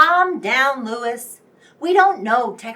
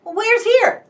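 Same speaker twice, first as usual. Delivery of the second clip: sudden high alarmed question; startled question as the news lands; alarmed surprise; 8 semitones higher than usual. Acted. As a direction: jolt up in shock. Shocked the boss arrived.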